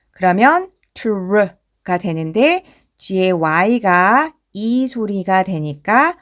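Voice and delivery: ordinary talk, nothing marked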